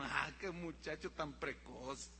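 low, soft, slow indulgent remark; amused parent. Acted. Dad humours his precocious little boy, soft amused drawl. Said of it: "es obvio que tú te ríes".

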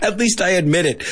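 giggling through the line; laughing at his own confession. Real, not acted. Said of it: "laughing at own meanness".

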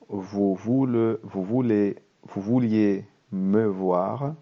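slow, careful French reading for learners. Shown as a demonstration. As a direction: read each word slowly so students can follow. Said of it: "leer el diálogo muy despacio". (lectura muy lenta y clara)

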